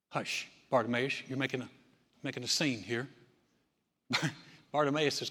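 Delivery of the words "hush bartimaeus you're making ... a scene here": softer, lower hushed scolding; hushing crowd. Retold or imitated. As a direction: hush him like an embarrassed bystander. Crowd hushing the blind beggar.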